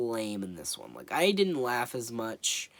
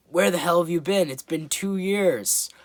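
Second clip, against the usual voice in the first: exasperated villain; 6 semitones higher, 7 dB louder than usual. Retold or imitated. Villain scolding the late snail.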